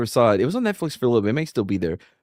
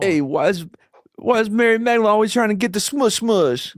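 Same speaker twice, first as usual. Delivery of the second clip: much higher, mock Jersey Shore gossip; a hammed-up Jersey bro, pitched way up; Jersey Shore accent; 9 semitones higher than usual. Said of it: "'Cause it's Jersey Shores".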